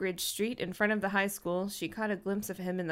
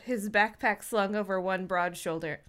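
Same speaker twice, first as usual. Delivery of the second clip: ordinary talk, nothing marked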